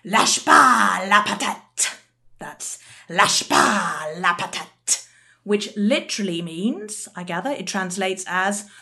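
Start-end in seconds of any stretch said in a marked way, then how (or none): 0.0-1.9 loud, slow, deliberate French phrase; exaggerated French accent, drawn out loudly; appalling French accent. Shown as a demonstration. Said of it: "excuse my probably appalling French accent". 3.1-5.1 slow, drawn-out French phrase; exaggerated French accent, word by word; appalling French accent. Shown as a demonstration. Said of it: "excuse my probably appalling French accent".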